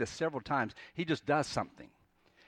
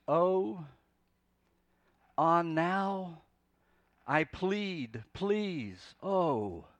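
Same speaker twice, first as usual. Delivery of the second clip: drawn-out pleading sighs with long pauses; deep longing; 3 semitones higher, much slower than usual. Acted. Jonah pleading with the Lord, in deep longing. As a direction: implore slowly, each word standing alone. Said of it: "It's this deep longing".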